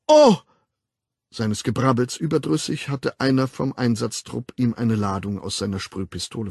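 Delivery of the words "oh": startled cry. Acted. Hit by the spray gun.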